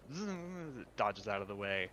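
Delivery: normal talking voice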